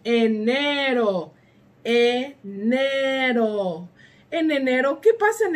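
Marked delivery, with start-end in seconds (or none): 0.0-1.3 1 slow model word. Shown as a demonstration. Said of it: "En español es". 1.8-3.9 Enero drawn out slowly, syllable by syllable; slow model word. Shown as a demonstration. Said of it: "En español es".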